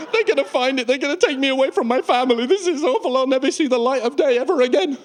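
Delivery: high, hammed-up despair; comic high-pitched panic; panicked wail. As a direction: wail as if you're doomed. Acted paranoid traveller fearing arrest.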